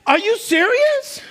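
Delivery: high, laughing disbelief; a preacher mimicking himself at a braking driver, incredulous. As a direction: throw up your hands in mock outrage. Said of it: "I'm like".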